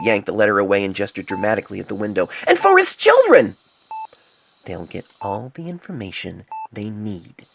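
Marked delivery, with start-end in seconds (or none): 2.5-3.6 very high, loud frantic yell; maniacal shouting. Acted. Furious reply. 4.6-7.5 slow, soft, even recitation; cool measured recitation; recited coolly. Acted. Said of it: "Poultice recited".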